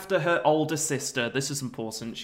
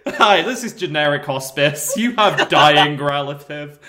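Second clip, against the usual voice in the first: mock flat receptionist; 8 dB louder than usual. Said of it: "They say".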